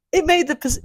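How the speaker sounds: usual speaking voice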